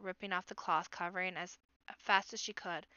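plain reading voice

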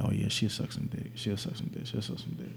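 fading to a whisper. Retold or imitated. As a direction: whisper it to yourself. Voicing his own horny thought.

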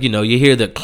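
ordinary talk

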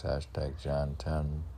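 normal talking voice

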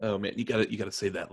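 usual speaking voice

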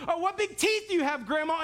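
put-on high-pitched storybook girl; innocent Little Red. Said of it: "she's just innocent".

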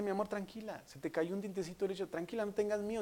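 soft, hushed reassurance; tender, quiet daddy voice; comforting father. Retold or imitated. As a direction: soothe a frightened little child. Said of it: "y los conforta y le dice".

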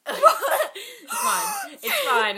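high laughing reassurance. Real, not acted. Laughing after mishearing her friend.

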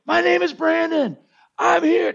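very high, sustained preacher's proclamation; revival preacher voice. Shown as a demonstration. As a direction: call it out high to a big congregation. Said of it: "you say with voices".

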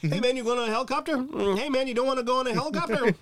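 high, fast mock bro invite; squeaky jokey pal asking him to come along; goofy buddy voice. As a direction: play a dopey coworker calling him along. Mimicking coworkers inviting Trump.

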